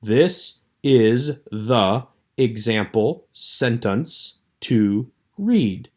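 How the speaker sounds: very slow, one word at a time; word-by-word demonstration, pausing after each word; sounded-out reading. Shown as a demonstration. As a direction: read it off a pronunciation guide, each word separate. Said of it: "reading it the way that it's pronounced here".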